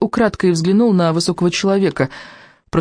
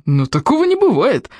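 higher-pitched protest; indignant cry; 7 semitones higher than usual. Acted. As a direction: cry out in disbelief. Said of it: "вскричал один из мракушников".

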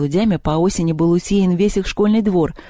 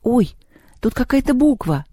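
higher, startled discovery; suddenly spot something; girl finding a letter on the acorn; surprised exclaim; 7 semitones higher than usual. A narrator's reading. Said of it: "воскликнула она".

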